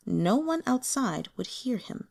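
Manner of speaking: ordinary delivery, nothing marked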